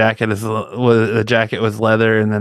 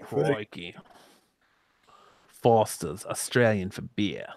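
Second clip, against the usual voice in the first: softer, slow put-on Australian accent; 7 dB quieter, slower than usual. Returning to the beer commercial bit, Australian accent. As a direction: slip back into the Australian advert voice, quietly. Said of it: "Now you got the voice".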